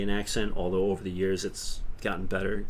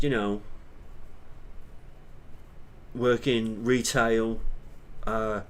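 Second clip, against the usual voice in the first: basic working-class Brit accent; working-class British accent. Shown as a demonstration. Streamer showing viewers a working-class Brit, British accent. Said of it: "just a basic British accent".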